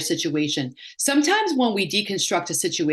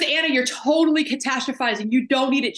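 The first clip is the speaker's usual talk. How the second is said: blunt friend; 7 semitones higher than usual. Retold or imitated. Friend calls her out.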